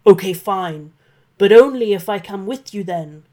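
loud, rough dragon giving in; grudgingly gruff, loud dragon. Acted. Dragon agreeing to show Santa upstairs, loud and rough. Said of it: "said the dragon roughly".

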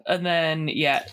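ordinary delivery

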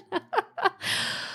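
high laughing burst; burst of laughter. Real, not acted. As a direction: laugh out in disbelief. Laughs at blower.

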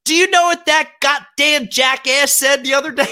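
high-pitched imitation of bubby; gossiping grandmother. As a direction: impersonate an old grandmother mocking a relative. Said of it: "she'll wanna make fun of somebody".

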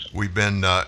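ordinary talk, nothing marked

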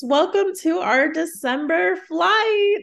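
high, loud, slow show opener; excited greeting. Acted. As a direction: greet listeners with big energy. Podcast host opening the episode.

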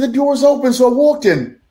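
high-pitched innocent explanation; acted-out customer justifying himself; retold self-defence.

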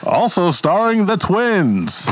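high, slow announcer voice; old Hollywood announcer. Acted. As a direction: announce the twins grandly. Announcer intro voice.

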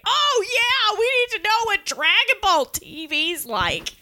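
hammed-up outcry without much volume; imitated sarcastic scream. Imitating a listener screaming from across the city, mock yell. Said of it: "I can hear Alex screaming from across the city".

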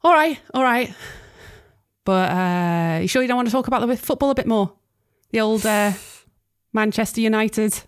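a lightly voiced pushy Manchester neighbour; pushy, in a Manchester accent. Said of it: "Trying to do a Manchester accent".

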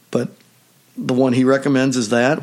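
ordinary talk, nothing marked

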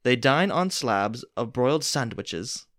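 hammed-up storyteller voice; playful theatrical narration; dramatic story reading. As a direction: read it like a grand dinner theater tale. Reads the Mad Lib story aloud.

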